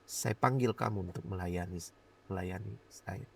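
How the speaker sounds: low, soft, solemn calling; hushed, understated divine summons; retold inner voice. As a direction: speak as God's voice within the heart. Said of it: "ada suara kuat sekali di hati saya".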